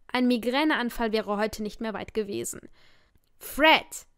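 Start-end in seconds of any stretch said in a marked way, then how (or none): 3.4-4.1 louder, higher exasperated call of the name. Acted. Narrator snaps at pleading Fred, exasperated and loud. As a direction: cut him off, fed up.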